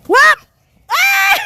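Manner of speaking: real high squeal breaking into laughter; whoop, shriek, then laugh it off; high yelp tumbling into giggles; excited squeal. Real, not acted. Playful kick game, squeal then laugh.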